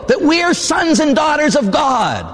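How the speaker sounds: high, slow proclaimed shout; booming pulpit proclamation; preaching shout. Real, not acted. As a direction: cry it out to the whole church.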